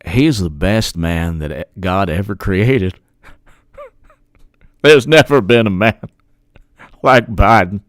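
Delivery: lightly put-on Graham Southern accent, gushing; Lindsey Graham accent. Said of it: "I don't know if I can do his, his accent".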